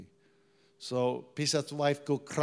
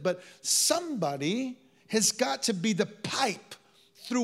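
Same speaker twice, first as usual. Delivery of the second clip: higher, louder sermon peak; preaching at full force; 8 semitones higher, 6 dB louder than usual. Acted. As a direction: drive it home to the congregation. Preaching climax.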